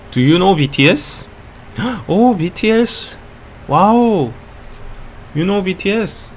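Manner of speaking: put-on excited fan with a Korean accent. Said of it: "like the Korean accent so much".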